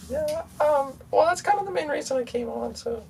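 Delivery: very high, thin comedic voice; squeaky high voice. Acted. Guest speaks in odd filtered voice.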